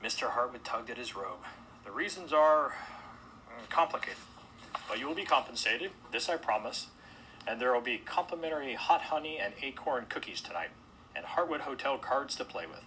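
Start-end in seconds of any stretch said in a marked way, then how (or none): 1.8-12.8 louder, higher placating host voice; stall, then promise compensation; evasive hotel host. Acted. Host placating guests with cookies and cards, evasive formality.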